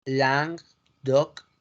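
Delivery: Languedoc slowly split, "lang... dok"; slow French Languedoc with a pause inside; French pronunciation. Shown as a demonstration. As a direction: break Languedoc into two slow French syllables. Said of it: "en español es".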